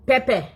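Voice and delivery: "Pepper" with no r, said pep-peh, falling; "Pepper" as pep-peh, the African way, no r. Shown as a demonstration. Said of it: "Say pepper".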